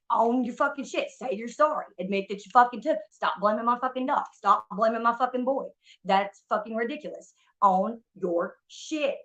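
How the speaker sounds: rapid-fire angry demands, then slowing; fed-up, forceful telling-off; heated demand. Retold or imitated. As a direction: lay into them, punching each demand. Acted rant at the absent friend.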